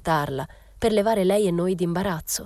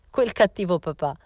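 mildly raised mock outcry; exclaiming; 3 semitones higher than usual. A narrator's reading. Momina laughing at Rosetta's mother, a mock exclamation. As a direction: exclaim it with teasing indignation. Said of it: "esclamò".

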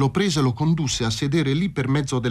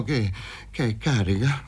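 hesitant, frightened stammer on the opening word; stammering question. A narrator's reading. Man facing a drawn revolver, stammering in fear. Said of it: "balbettò".

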